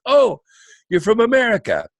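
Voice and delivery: high, loud burst of recognition; Italian night manager. Retold or imitated. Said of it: "the night manager is Italian".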